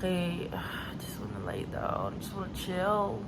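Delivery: soft, slow, worn-out sigh of words; tired. Real, not acted. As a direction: let it sag, longing to lie down. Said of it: "Kapoy ka". (kapoy, hinay ug hinayhinay nga tingog)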